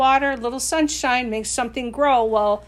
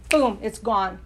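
ordinary delivery

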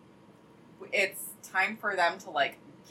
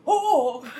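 sudden high yelp of surprise; a neighbor caught off guard, blurting oh; startled oh; 8 semitones higher, 7 dB louder than usual. Retold or imitated. Neighbor startled by the cat in the hallway, loud surprised yelp. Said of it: "I just hear him go".

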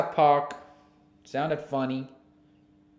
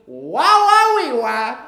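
silly wah noises; 8 semitones higher, 12 dB louder, slower than usual. Pretend. Silly noises after happy.

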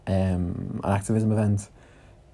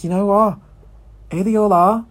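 higher, louder wry exclamation; mock exasperated; 11 semitones higher, 4 dB louder than usual. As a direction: throw up your hands, half laughing. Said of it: "And I was just like".

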